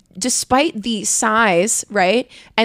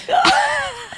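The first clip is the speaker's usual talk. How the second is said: shrieking laugh. Real, not acted. Laughing at own story.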